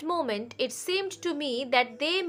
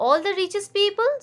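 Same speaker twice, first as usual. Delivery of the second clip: bewildered; 7 semitones higher, 3 dB louder, faster than usual. A narrator's reading. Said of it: "said Hope in bewilderment".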